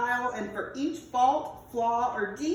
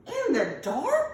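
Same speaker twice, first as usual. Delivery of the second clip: shocked cry; 5 semitones higher, slower than usual. Acted. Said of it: "cried Waddle".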